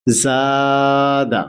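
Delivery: জাদা stretched out very slowly, each syllable drawn long; slow blended reading. Shown as a demonstration. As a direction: drag out জাদা slowly as a model for pupils. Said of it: "আগে বানান করে নিতে হবে যে এইভাবে".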